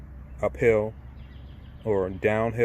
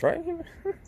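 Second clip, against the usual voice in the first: laughing; 4 dB quieter than usual. Real, not acted. Laughing at the coronavirus excuse.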